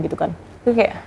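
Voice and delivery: ordinary talk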